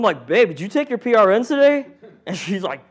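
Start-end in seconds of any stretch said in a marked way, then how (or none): 0.3-1.9 higher, louder teasing ask of his wife; mock pointed question. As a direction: needle her with a sly question. Speaker voices himself asking his wife.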